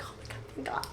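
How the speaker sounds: whispered thanks, barely any voice; relieved whisper. Retold or imitated. Job seeker after the call, relieved whisper. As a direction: re-enact relief in a hushed whisper.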